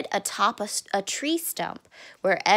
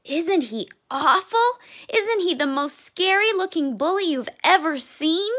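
put-on alarmed child; 7 semitones higher than usual. Scared cub describes bully to mother.

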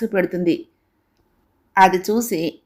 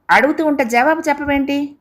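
higher-pitched acted scolding; raised pitch, insistent rebuke without a shout; stern, forceful; 7 semitones higher than usual. A narrator's reading. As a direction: demand an answer like an exasperated father. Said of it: "గట్టిగా అన్నాడు".

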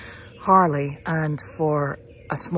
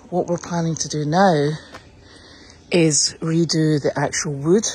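ordinary talk, nothing marked

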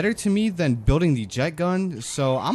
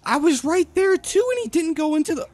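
raging gamer; 5 dB louder, slower than usual. Real, not acted. Dies suddenly, screams in disbelief.

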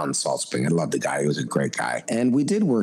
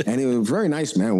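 normal talking voice

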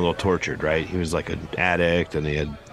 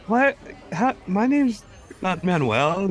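put-on high boy's voice, correcting his name; squeaky schoolboy protest; prepubescent boy voice; 10 semitones higher, slower than usual. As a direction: keep the pitched-up teen boy going. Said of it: "Your voice has gotten just more like".

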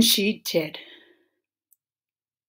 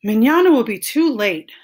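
exasperated protest; 4 semitones higher, 8 dB louder than usual. A narrator's reading. Iguana protests her friends' delay.

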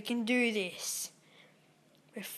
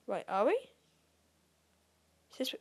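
ordinary talk, nothing marked

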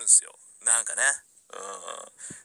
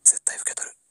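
whispered promise. Acted. Hero whispers a promise while holding Rabucho. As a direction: promise it under your breath. Hugging her close.

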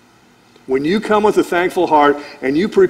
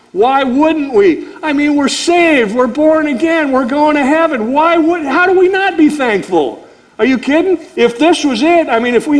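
much higher, climbing with joy and disbelief; excited preaching; 8 semitones higher than usual. Real, not acted. Preacher urging the congregation to thankfulness, excited and high. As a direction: lift it up, they're saved, how can they not be thankful.

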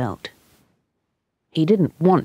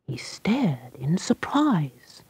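plain reading voice

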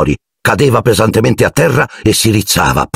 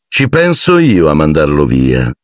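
indolent; 2 semitones lower than usual. A narrator's reading. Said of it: "con tono indolente".

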